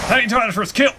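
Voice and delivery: loud, high triumphant shout; excited cheer. Real, not acted. Cheering a first kill.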